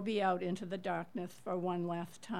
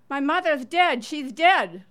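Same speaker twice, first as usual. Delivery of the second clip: higher, louder retold cry of grief; retold scream; 6 semitones higher, 12 dB louder than usual. Young woman screaming her mother died, retold loud cry. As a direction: voice the daughter's screamed news, not full force. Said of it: "the young woman screamed".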